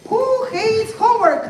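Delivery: high-pitched hype-host question; rallying call. Pretend. Host asks the audience who hates homework, rallying.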